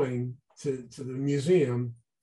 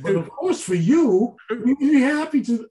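gushing delight; 10 semitones higher, 8 dB louder than usual. Retold or imitated. Host's gushing permission, retold.

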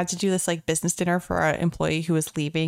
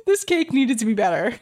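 mock indignant; 7 semitones higher than usual. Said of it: "And I was like".

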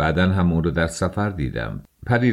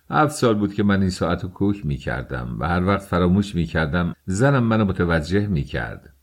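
ordinary delivery, nothing marked

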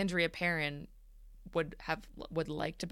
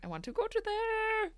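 squeaky catchphrase echo. Retold or imitated. Echoing the catchphrase.

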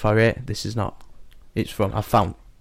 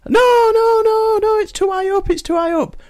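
panicked woman; 3 dB louder than usual. Acted. Woman answers from burning window.

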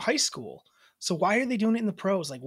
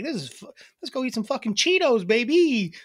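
goofy snack-run enthusiasm, pitched up; silly; 4 semitones higher than usual. Acted. Host showing how she swears for fun, silly and playful. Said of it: "I'll say it to be silly".

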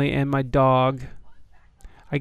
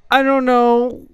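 high puzzled shrug; 9 semitones higher than usual. Real, not acted. Reacting to odd email.

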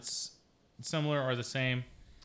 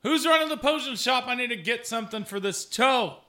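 raised, high calling voice; yelling out; 9 semitones higher, 4 dB louder than usual. Acted. Injured man seeking the potion shop keeper, yelling out. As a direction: call out across the town for help. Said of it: "I start yelling out".

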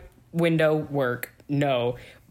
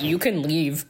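polite dismissal. Retold or imitated. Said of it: "And I'm like".

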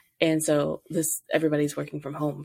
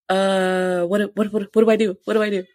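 higher and louder, frantic repeats; panicked; 4 semitones higher, 6 dB louder than usual. Retold or imitated. Wife on FaceTime to husband, panicked and loud. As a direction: spiral into panic over the result. Panicking over a positive test.

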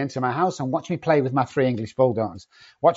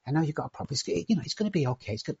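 much softer, gentle reassuring tone; mock soothing counsellor; 10 dB quieter, faster than usual. Imitating a soft counsellor reassuring an addict, much softer. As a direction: coddle him like a soft therapist would. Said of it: "if I come up and sat down in front of them and say".

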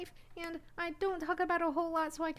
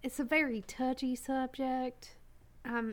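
lower-pitched Southern character voice; Southern accent; 4 semitones lower than usual. Acted. Costumed guest on a touchy subject, low Southern accent. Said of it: "I'm just trying to get into character".

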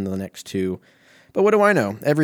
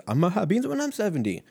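half-sung, lightly voiced rapper line, higher; 9 semitones higher than usual. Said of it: "that sounded more like Tupac".

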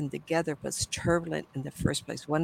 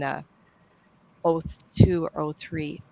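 usual speaking voice

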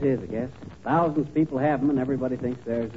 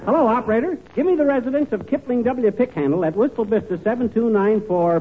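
loud phone call; 7 semitones higher, 3 dB louder than usual. Acted. Calling operator on the phone.

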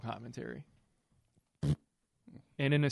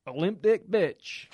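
loud, higher, drawn-out insult; mock insult; 5 semitones higher, 6 dB louder, much slower than usual. Podcast host acting out wife's insult to husband, mock insult. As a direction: stretch out each word with hammed-up force.

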